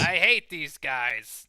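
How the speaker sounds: slow, loud movie-hero growl of disgust; quoting the hero, mocking Nazis at his friend; Indiana Jones. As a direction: drag out a famous film line with contempt. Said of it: "give me some of that Indiana Jones".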